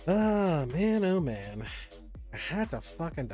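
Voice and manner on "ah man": normal talking voice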